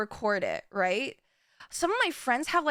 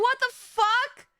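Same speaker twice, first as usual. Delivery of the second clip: stunned disbelief; 9 semitones higher, 3 dB louder, slower than usual. Retold or imitated. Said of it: "And I was just like".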